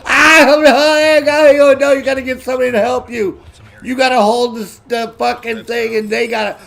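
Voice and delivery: high, loud, flustered objection; fussy airman. Retold or imitated. Airman stops Sarge hammering the stake, high and flustered.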